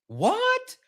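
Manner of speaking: high rising shocked what; hammed-up surprise; mock shock. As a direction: throw up a startled what.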